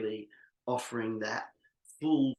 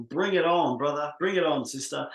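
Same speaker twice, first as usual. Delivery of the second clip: loud, raised-pitch rallying cheer; 4 semitones higher, 7 dB louder than usual. Pretend. Imagined clients cheering the speaker on, excited cheer. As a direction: cheer them on like an eager crowd. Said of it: "maybe they're cheering and clapping".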